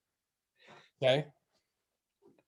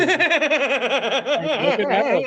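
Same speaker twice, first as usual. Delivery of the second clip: laughing; 8 semitones higher, 4 dB louder, much slower than usual. Real, not acted. Laughing while describing audience.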